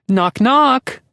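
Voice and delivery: very high, loud squeaky call. A narrator's reading. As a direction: squeak it out cheerfully. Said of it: "a high, squeaky voice calls out".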